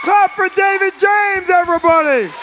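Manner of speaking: loud, high emcee shout-outs; rally the crowd for each act; announcer hype. Acted. Host hyping acts.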